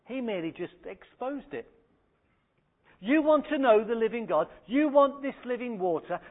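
usual speaking voice

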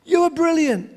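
put-on boy calls praise into the valley; called out. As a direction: call the praise across the valley. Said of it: "shouted out".

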